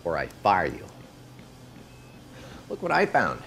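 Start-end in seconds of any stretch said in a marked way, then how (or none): 2.7-3.4 high, drawn-out cutesy coo; teasing singsong. Acted. Boss showing off a colleague's kids, teasing singsong. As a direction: coo it high and slow to needle him.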